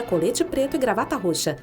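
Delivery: usual speaking voice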